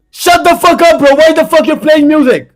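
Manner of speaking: high, loud real outburst; exasperated high-pitched shouting; furious yell. Real, not acted. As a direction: yell at a noisy neighbour to stop. Yelling at someone playing music.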